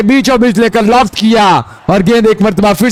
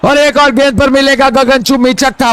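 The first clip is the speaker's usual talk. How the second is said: excited cricket commentary; 6 semitones higher than usual. Real, not acted. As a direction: shout the six over the umpire. Another six called live. (छक्के पर ऊँची जोशीली commentary)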